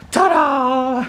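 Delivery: high triumphant ta-da. Real, not acted. Car flipped over successfully.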